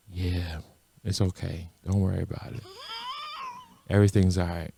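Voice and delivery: soft, eerily calm and quiet; scary calm. Retold or imitated. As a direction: talk soothingly but with menace underneath. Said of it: "he has that scary calm".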